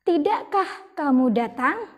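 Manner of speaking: usual speaking voice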